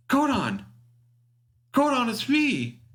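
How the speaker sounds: very high-pitched woman's call over an intercom; Zakata calling out. Acted. Woman hails Godon over the ship's intercom, calling high. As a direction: call out high, drawing out the words. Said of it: "from the voice of, uh, Zakata saying".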